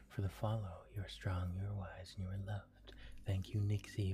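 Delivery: ordinary delivery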